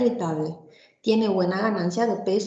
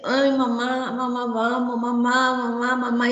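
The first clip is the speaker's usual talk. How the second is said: lightly voiced small child whining for mamá; whiny sick child; 2 semitones higher than usual. Restless child calling mamá before vomiting, light whine. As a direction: plead like a queasy little kid tugging at mom.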